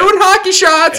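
high, loud buddy-buddy greeting; mock friendly bro. Befriending the hockey neighbour in a hammed-up bro voice. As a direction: sweet-talk him like an old pal.

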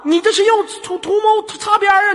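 high-pitched indignant challenge; mock-outraged accusation. Host accusing caller of innuendo, mock outrage. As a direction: press the charge, hammed-up.

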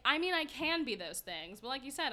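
ordinary delivery

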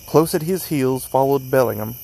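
plain reading voice

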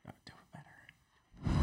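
hushed aside; whispering. Real, not acted. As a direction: whisper the coaching note. Coaching a whisper.

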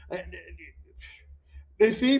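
ordinary talk, nothing marked

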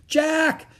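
high, loud call of a name; urgent high yell; crying out. A narrator's reading. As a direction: call out to someone in the dark. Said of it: "cried Annie".

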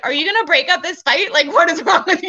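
re-enacted scolding with a laugh; imitated indignant demand.

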